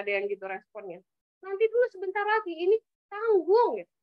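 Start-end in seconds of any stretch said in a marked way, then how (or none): 1.5-3.8 put-on high child's complaint, rushed then dragged; child whining back. Child refusing to quit the game, whining high at mom. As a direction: whine that it's not a good time to stop, pitched high. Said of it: "anak udah mulai nih nadanya agak keras".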